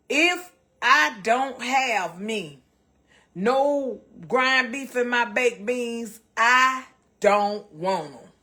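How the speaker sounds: slow, loud, word by word; emphatic insistence. Real, not acted. Cook insisting on ground beef in beans, slow and emphatic. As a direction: lay down the rule, stretching each word. Laying down her rule on beans.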